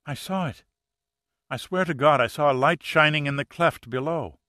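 high, pleading insistence; insist, desperate to be believed; insistent. Acted. Pleading that she saw light.

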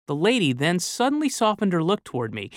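ordinary delivery

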